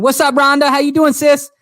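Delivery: loud, high-pitched shout-out; excited greeting. Real, not acted. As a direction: call out a big hello to a friend. Greeting viewers joining the live.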